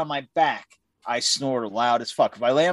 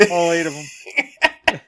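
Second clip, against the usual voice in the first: slow spider voice; 4 semitones higher, much slower than usual. Pretend. Voicing the spider.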